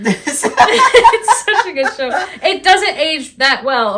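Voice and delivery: high, slow words through laughter; laughing. Real, not acted. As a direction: laugh while gushing. Laughing at her own gushing.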